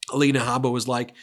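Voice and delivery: normal talking voice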